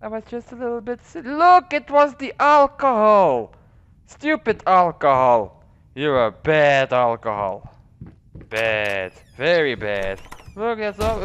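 high, loud sing-song excuses; hammed-up high pretend voice; a hint of a tipsy excuse-maker. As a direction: play the guilty drinker blaming alcohol. Playful excuse about alcohol.

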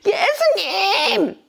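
high, drawn-out cry; long calling out. Acted. Blind men calling after passing Jesus, long high cry. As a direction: stretch the name out to carry far.

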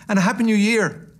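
loud, high parting wish; cheery nephew. Acted. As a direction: call out merrily, undeterred. Nephew's parting wish despite dismissal.

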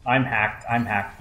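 normal talking voice

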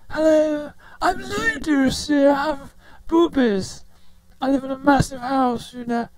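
high, mock grand lady; female voice effect. Shown as a demonstration. Demonstrating the female preset as lady of a massive house, high lady voice. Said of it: "then you got females too".

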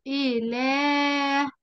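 إله stretched very slowly as ilaah; drawn-out demo word. Shown as a demonstration. Teacher listing words with a hidden alif, very slow. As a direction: sound out إله with a long aa. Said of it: "إيه كمان؟".

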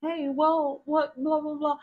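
high, chatty put-on voice; nosy fake friend. Nosy person prying into your business, high mock chatter. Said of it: "This person".

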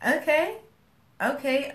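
high startled okay okay; mock stunned acceptance; retold surprised okay; taken aback. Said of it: "I'm like".

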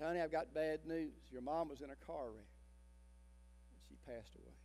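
put-on a pastor quietly telling a girl her mother has died; gentle bad news. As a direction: break it to her as gently as you can. Pastor breaking terrible news.

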